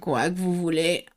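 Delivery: lightly voiced old frog, low and rasping; a croaky old frog grumbling; hoarse frog voice. Old frog answering Alice at the door, low and hoarse. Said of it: "d'une voix grave et enrouée".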